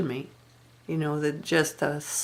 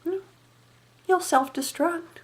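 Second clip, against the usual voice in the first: cold dismissive verdict; 12 semitones higher, 4 dB quieter than usual. Retold or imitated. Mother-in-law coolly answering Margaret's fears. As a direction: toss it off with a little shrug. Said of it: "You know what she said".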